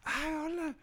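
put-on very high soft girly voice; imitating the girl. Imitating the girl answering him, very high and soft.

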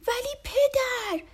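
put-on high, slow childlike plea; a little boy begging his father; pleading little boy. A narrator's reading. Boy pleads with his father, high and drawn out. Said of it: "فرانکلین گفت".

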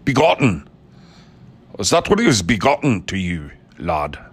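mock Scottish movie-star brogue; Sean Connery. Podcaster hamming up the word begotten, in a Sean Connery accent. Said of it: "say it in a Sean Connery accent".

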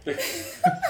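a high, breathy burst of giggling; stifled giggles. Real, not acted. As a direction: giggle you can't hold back. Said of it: "já começa a rir".

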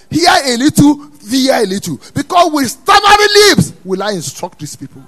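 rhythmic phrases building to a shout; high, loud pulpit cadence; full-force preaching. Real, not acted. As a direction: climb to a shouted climax.

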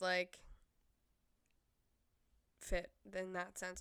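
usual speaking voice

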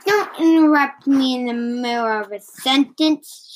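usual speaking voice

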